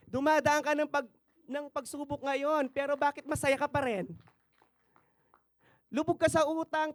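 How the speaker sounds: high, loud pulpit challenge; rally the church with rising fire; a preacher's fervent call to the congregation; full-force preaching. Acted.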